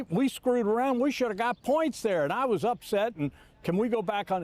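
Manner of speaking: imitating an upset coach apologizing; worked-up apology. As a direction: plead your case, all worked up.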